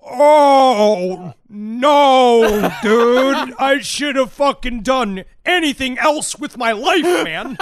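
high, loud, drawn-out comic wail; distraught stoner dude. Acted. Stoner buyer finding the bodies, wailing in mock despair. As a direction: lament it like a hammed-up burnout.

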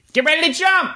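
very high-pitched yell in character; high, strained character shout; Hurley. Acted. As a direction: call a warning in a squeaky voice. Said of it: "yells to".

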